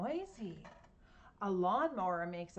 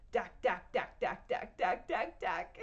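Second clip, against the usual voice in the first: louder, hammering dack, dack; jackhammer; 5 dB louder than usual. Shown as a demonstration. Reading the jackhammer sound to kids: loud dack. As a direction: pound it out like a jackhammer. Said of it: "the jackhammer goes".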